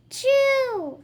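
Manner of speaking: loud, high fake sneeze; big sneeze. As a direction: blast out a hammed-up sneeze. Character sneezes in story.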